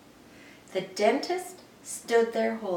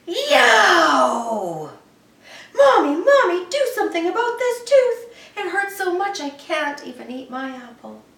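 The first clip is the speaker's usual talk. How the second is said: put-on high, loud child's howl of pain; pained yell; 10 semitones higher, 12 dB louder than usual. A narrator's reading. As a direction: wail it like a kid with a sore tooth. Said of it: "and yelled".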